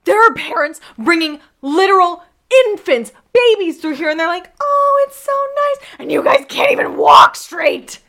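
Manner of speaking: high, loud mock scolding of fed-up mother; frustrated mom. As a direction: mom losing patience with the kids on a bench. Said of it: "And she's like".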